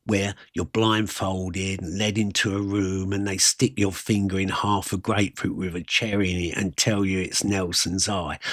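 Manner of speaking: ordinary delivery, nothing marked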